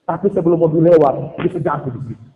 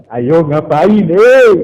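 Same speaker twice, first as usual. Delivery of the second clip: acted challenge; 7 dB louder, much slower than usual. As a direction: challenge rising to a loud "eh?". Acted challenge to someone caught. (seruan menegur yang makin keras)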